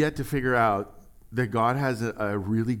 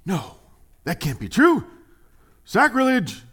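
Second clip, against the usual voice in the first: louder and much higher, mock scandalized; hammed-up outrage; 8 semitones higher, 8 dB louder than usual. Modern believers scandalized that John outdrew Jesus, hammed-up outrage. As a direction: cry sacrilege like a shocked churchgoer, tongue in cheek. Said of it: "it's like".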